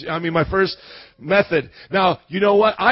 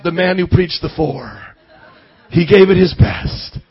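mock epitaph; 3 semitones lower, 3 dB louder than usual. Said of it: "the epitaph".

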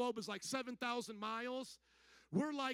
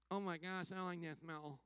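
disgusted visitors; 3 semitones lower, 3 dB quieter than usual. Retold or imitated. Travelers reacting to smells in India, mock disgust. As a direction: lower mock complaint in a put-on voice. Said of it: "many of us have said".

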